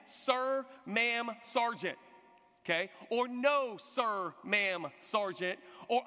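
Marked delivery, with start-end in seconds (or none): 0.1-2.0 slow, word-by-word drill answer; careful recruit's reply; measured military response. Retold or imitated. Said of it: "make sure I say it right". 3.3-5.6 slow, word-by-word drill answer; careful recruit's reply; measured military response. Retold or imitated. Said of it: "make sure I say it right".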